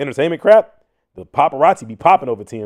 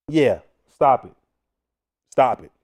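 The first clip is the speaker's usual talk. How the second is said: usual speaking voice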